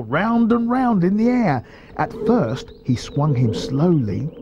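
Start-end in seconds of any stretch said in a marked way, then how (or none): none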